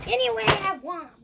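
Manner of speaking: high delighted exclaim; excited loud. Real, not acted. Excited about toy.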